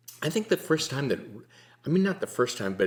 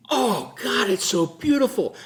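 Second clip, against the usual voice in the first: higher and louder, breathless wonder at the snow; awed exclamation; 13 semitones higher, 6 dB louder than usual. Retold or imitated. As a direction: gasp it out in awe.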